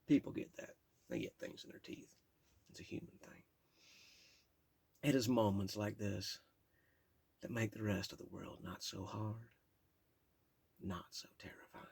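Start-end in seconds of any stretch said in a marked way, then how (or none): 0.1-3.4 soft unvoiced aside; whispered aside. Real, not acted. Aside about a walnut in his tooth: whispered. 10.8-11.8 whispered. Real, not acted. Trailing into a whisper.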